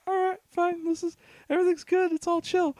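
high, soft, overly casual reassurance; forced calm. Retold or imitated. Narrator reassuring himself at the exit, high forced calm. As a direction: talk yourself into calm, lightly. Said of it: "I'm just kinda like".

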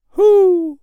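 loud, very high hoot; owl hoot. A narrator's reading. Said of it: "said Howl the owl".

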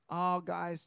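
normal talking voice

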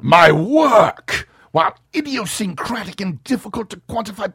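high, loud villain voice; hammy Lex, indignant; new Lex voice. Acted. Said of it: "a different voice for Lex".

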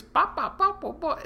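high popping bop noises; loud slapstick yelps. Retold or imitated. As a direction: pop out bops way up high. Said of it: "doing this weird shit with his mouth".

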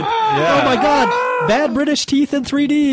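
very high, slow, drawn-out yell; hammed-up awestruck shouting; mock-amazed shout. Co-host joking about British 3D, mock-amazed shout.

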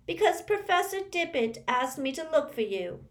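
high taunting schoolgirl; mocking bully voice. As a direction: sneer it in a nasty sing-song. Olive Hornby quoted.

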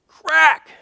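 sharp, high crack noise; imitated crack sound. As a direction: snap the word out like a bone hitting ground. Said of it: "I heard this".

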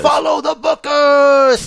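high, slow, loud promo call; wrestling promo voice. Acted. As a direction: hype it like a ring announcer.

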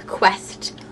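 whisper. Acted. Dramatic whispered word.

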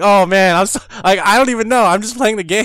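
high, loud mock helplessness; flustered protest. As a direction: retell throwing up your defence, loudly. Retold flustered defence to critical friend.

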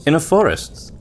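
ordinary talk, nothing marked

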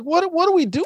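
high, puzzled retold question; mock bewilderment. Retelling a confused actor on set, mock bewilderment. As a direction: push the pitch up, as if lost.

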